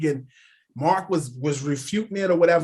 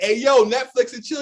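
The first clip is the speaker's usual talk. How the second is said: playful call-out; 7 semitones higher, 4 dB louder than usual. Retold or imitated. Teasing comment to Redick.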